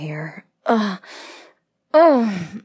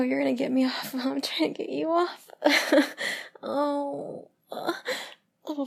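higher, breathy gasps between phrases; aroused moaning; 5 semitones higher, 5 dB louder than usual. Acted. Lover reacting to a partner's touch, higher aroused moaning. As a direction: let pleasure push the voice up.

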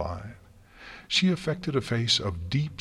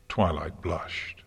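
ordinary delivery

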